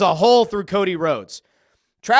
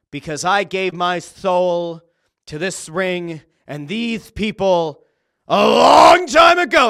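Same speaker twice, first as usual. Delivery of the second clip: a hint of Cody's solemn promo voice rising to a climax; dramatic promo quote; 7 dB louder, slower than usual. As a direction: drag it out like a big wrestling promo, swelling on the last words. Quoting Cody's dramatic promo line.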